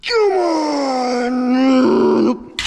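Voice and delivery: strained cry of effort; straining. Real, not acted. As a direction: grunt it out through the rep. Straining through a heavy rep.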